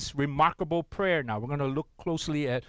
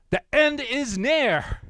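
higher, louder and slow, like a doom prophet; proclaiming the sign; 9 semitones higher, 6 dB louder, slower than usual. Acted. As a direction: boom out the warning slowly. Reading the rabbi's sign.

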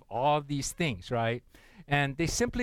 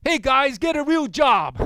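much higher and louder mocking yell; yelled at them; 9 semitones higher, 9 dB louder than usual. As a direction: shout it from a passing car. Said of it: "he yelled".

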